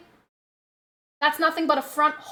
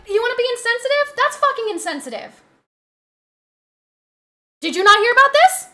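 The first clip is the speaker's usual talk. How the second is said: angry outburst; 8 semitones higher, 5 dB louder, faster than usual. Real, not acted. Angry rant.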